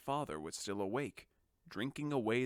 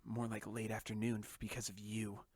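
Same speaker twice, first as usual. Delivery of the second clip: softer muttered sarcastic aside; under the breath; 2 semitones lower, 6 dB quieter than usual. Acted. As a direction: mutter it so nobody hears. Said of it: "I said under my breath".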